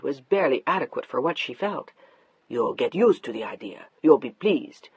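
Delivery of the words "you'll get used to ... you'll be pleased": lower, settled and sure; a hint of a complacent husband; smug husband. Mark telling his wife she'll accept it, low and sure.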